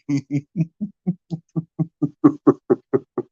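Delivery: laugh. Real, not acted. Laughing at his own impression.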